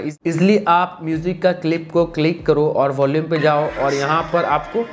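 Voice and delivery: usual speaking voice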